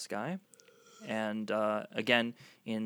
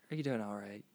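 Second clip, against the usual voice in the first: normal talking voice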